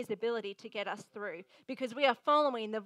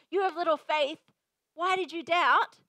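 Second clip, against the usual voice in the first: louder, higher mock reproach; harsh rebuke; 3 semitones higher, 6 dB louder than usual. Quoting what a leader should not say, harsh rebuke. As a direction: act out a pointed telling-off. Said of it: "That is not how you address".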